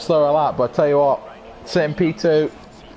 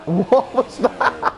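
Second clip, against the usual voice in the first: laughing disbelief; 2 semitones higher, much slower than usual. Real, not acted. Stunned by a dive bomb.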